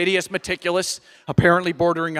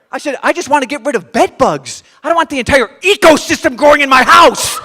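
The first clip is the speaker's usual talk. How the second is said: loud and higher, laughing in disbelief; exasperated outburst; 7 semitones higher, 10 dB louder than usual. Retold or imitated. Exasperated at the toad advice, he shouts, laughing. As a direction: yell at the absurdity, cracking up.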